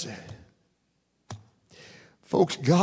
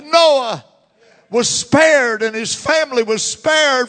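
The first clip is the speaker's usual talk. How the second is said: "Noah" rung out higher and louder, falling; ringing preacher call; 5 semitones higher, 5 dB louder, slower than usual. Real, not acted. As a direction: cry the name "Noah" out to the congregation. Preaching at full force.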